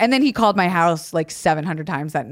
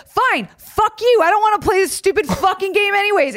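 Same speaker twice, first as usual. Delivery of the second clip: put-on higher, defiant teen yelling back; defiant outburst. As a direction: fire it back at the coach, fed up. Teen storming off at coach.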